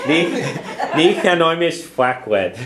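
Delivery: kyan jarag not it, laugh through ni chanoimish flakwed. Real, not acted. Teacher cracks up at her own cheeky example, laughing. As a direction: giggle through the Irish line.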